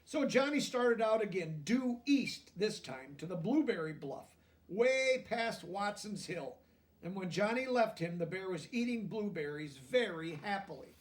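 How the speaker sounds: lively picture-book narration; reading to children. A narrator's reading. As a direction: read it like a bedtime story to kids.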